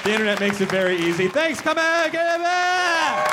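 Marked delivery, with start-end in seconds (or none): 1.3-3.3 much higher, slow drawn-out call; high sign-off call. Pretend. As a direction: sing it out high. Sign-off flourish.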